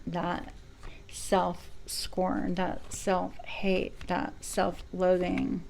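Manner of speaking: drawn out, each word slowed right down; slow, weighty emphasis on the painful words. Real, not acted. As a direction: let each harsh word sink in slowly. Naming harsh self-judgments.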